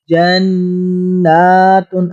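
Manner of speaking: جَنَّاتٌ with the doubled noon held long in the nose, slowly; ghunna on noon. Shown as a demonstration. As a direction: stretch the نّ in جَنَّاتٌ as a nasal hum. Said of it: "گنہ کریں گے".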